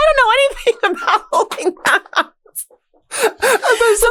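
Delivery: high voice breaking into laughter; giggling through the quote; laughing. Real, not acted. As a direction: read it while cracking up. Laughing while reading quote.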